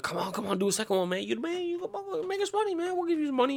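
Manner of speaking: high, soft coaxing voice; mock studio execs. Studio coaxing [name] into a sequel, high and wheedling. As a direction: wheedle him like greedy producers. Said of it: "the studio was like".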